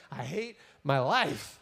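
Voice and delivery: slow, low acted despair; hammed-up self-pity; mock misery. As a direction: drag it out low like a sulker. Jonah's misery in the hot wind.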